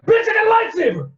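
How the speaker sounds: high, loud shout; triumphant shout. Retold or imitated. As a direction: yell it like a battle cry. Obi-Wan attacks the thug.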